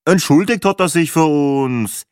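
congested Viennese character voice; stuffed-up Viennese. A narrator's reading. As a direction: answer in sniffly Viennese dialect. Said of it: "ebenso verschnupft wie wienerisch".

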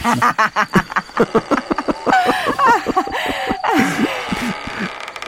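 high, cackling villain laugh; evil laugh. Acted. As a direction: cackle with cruel delight. Killer laughs after the murder.

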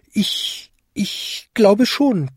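high, hesitant little bell; falter, then slowly chime it high; embarrassed. A narrator's reading. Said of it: "klingelte Vinzi verlegen". (verlegen klingelndes Glöckchen)

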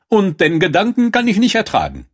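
hoch gespielte Prinzessin, abweisend. Acted. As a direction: die Prinzessin deutlich höher, schnippisch. Prinzessin angewidert. (die Prinzessin will den Frosch loswerden, hoch und abweisend)

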